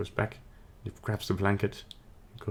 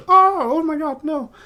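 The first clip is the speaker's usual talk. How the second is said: high, loud mock-terrified cry; hammed-up burst of panic; panicked scream; 9 dB louder than usual. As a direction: shriek as if thunder struck right beside you. Character terrified by thunderclap in story.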